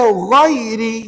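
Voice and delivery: GHAIRI with a light r before i; slow GHAIRI, keeping the r thin. Shown as a demonstration. Teacher points out the only thin ra, GHAIRI with light r. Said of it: "Inilah satu-satunya ra nipis".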